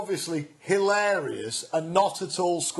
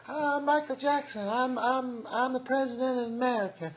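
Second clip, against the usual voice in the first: a breathy-free lightly higher celebrity greeting; 7 semitones higher, 3 dB quieter than usual. Retold or imitated. Comedian voicing [name] meeting the prime minister. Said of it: "He'll say".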